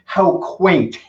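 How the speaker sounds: put-on dry, amused Scottish accent; Scotty's Scottish accent. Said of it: "you're gonna do your Scottish accent".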